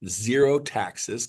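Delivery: usual speaking voice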